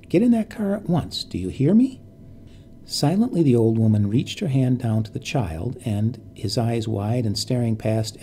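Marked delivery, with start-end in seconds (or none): 0.1-2.0 insistent command. Acted. Repeated order, more insistent.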